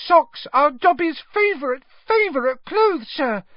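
high, squeaky elf voice, overjoyed; delighted house-elf. A narrator's reading.

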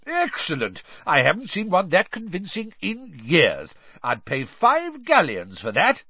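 high-pitched, gleeful admiration; thrilled, jovial enthusiast; delighted. A narrator's reading. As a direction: marvel at a brilliant joke item. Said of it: "Bagman roared with laughter".

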